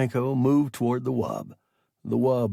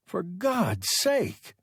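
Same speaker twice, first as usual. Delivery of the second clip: exclaiming; 7 semitones higher, slower than usual. A narrator's reading. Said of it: "Peterson exclaimed".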